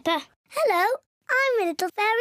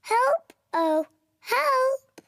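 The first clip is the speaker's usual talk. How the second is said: mock call for help; 5 semitones higher, much slower than usual. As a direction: call out "Oh, help!" again to cue the hunter. Repeats cue for shy Pedro.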